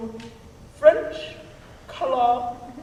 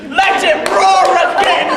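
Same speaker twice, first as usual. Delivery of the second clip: louder and higher, bold call; loud cheer; 7 semitones higher, 9 dB louder than usual. Retold or imitated. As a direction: shout it out like a demand. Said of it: "make the Duke say".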